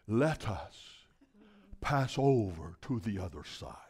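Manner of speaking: hushed formal reading of the verse; hushed formal scripture. Shown as a demonstration. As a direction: read it soft and stately, like old scripture. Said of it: "He said something to the effect of".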